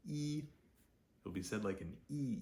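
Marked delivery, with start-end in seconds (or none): 0.1-0.4 E as "ee", high and level; letter-name E. Shown as a demonstration. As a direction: hold E on one high, steady note. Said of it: "it will be said like".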